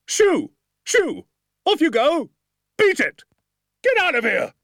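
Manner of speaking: very high, loud shooing; shouting. A narrator's reading. Sergeant shoos pheasants away, very high and loud. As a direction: shoo them off with arms waving. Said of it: "waving his arms ... and shouting".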